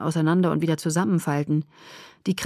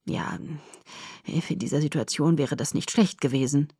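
sheepish, lower-pitched admission; hesitant start, conceding the point apologetically; guilty; 4 semitones lower, 4 dB quieter than usual. A narrator's reading. Doctor admitting to the father, guilty. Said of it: "Doktor Grundy klang schuldbewusst".

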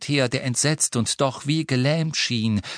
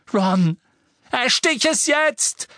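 much higher, loud roaring order; 14 semitones higher, 5 dB louder, slower than usual. A narrator's reading. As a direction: bellow the command in panic. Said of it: "brüllte Harry". (Harry brüllt Ron an, jetzt zuzustechen)

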